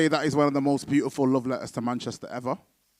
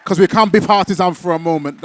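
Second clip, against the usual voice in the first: much louder and higher hype shout; rally the whole room; excited host shout to the crowd; 4 semitones higher, 12 dB louder than usual. Real, not acted.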